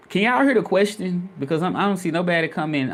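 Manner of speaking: asking again, a little fed up; exasperated. Real, not acted. Streamer pleads with silent chat, exasperated. Viewers ignore his repeated question.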